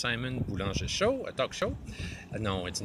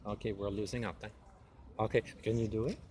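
ordinary talk, nothing marked

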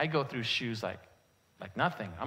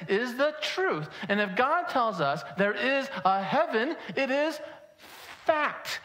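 forceful, raised sermon voice hitting key words; preaching; 6 semitones higher than usual. Real, not acted. Pastor declaring God cannot lie, preaching emphatically. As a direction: build in insistent, repeated declarations.

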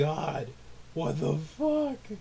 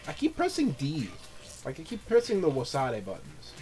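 normal talking voice

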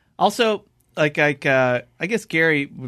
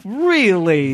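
higher, incredulous drawn-out question; retold disbelief; 6 semitones higher, 4 dB louder than usual. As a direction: act out stunned disbelief at the brush-off. Retold disbelief at being refused.

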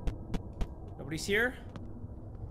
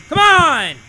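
loud, high cry of frustration; real exasperated yell; exasperated shout; 11 semitones higher, 10 dB louder than usual. Real, not acted. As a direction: shout it at whoever is chasing you. Exasperated at pursuer in game.

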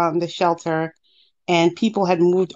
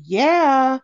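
higher, drawn-out mock-irritated answer; kind of annoyed; 7 semitones higher, 4 dB louder than usual. Mom answers child from the stairs, kind of annoyed. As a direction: imitate a parent's mildly annoyed reply. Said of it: "like, kind of annoyed".

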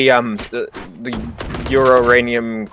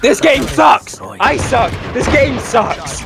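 frustrated yelling; 11 semitones higher, slower than usual. Real, not acted.

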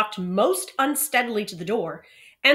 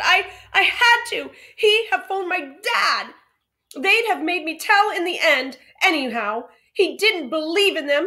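tearful plea; 11 semitones higher, 9 dB louder than usual. Acted. Boy who has been crying explaining himself, tearful plea. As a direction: plead it out through tears.

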